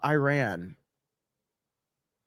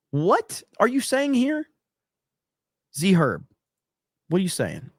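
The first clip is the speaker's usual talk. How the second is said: baffled; 5 semitones higher than usual. Real, not acted. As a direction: ask it like you can't believe it. Baffled by a chat comment.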